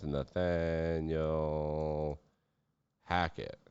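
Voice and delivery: very slow, stretched-out syllables; drawn-out name. Real, not acted. Podcaster dwelling on a failing coach's name, drawn out. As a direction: draw the name right out, then pause before the surname.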